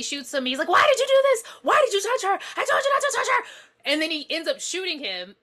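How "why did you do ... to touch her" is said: loud, high hysterical imitation; crazed yelling. Retelling how the shooter goes crazy at his friend, acted crazed yelling. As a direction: yell it high like someone losing it. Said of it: "And he just goes crazy".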